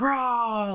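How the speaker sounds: very high mock roar; drawn-out hammed-up rah; crowd cheer. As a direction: imitate a cheering mob. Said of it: "they're all cheering in the back".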